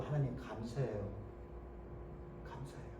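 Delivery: soft, fading prayer of thanks; mild whispered gratitude; hushed thanks. Retold or imitated. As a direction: murmur it like a kneeling believer.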